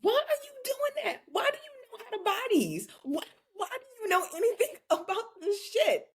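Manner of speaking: very high-pitched mock wail; mock exasperation. Host scolding girls who do boy stuff, mock exasperated. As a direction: squeal it in exaggerated disbelief.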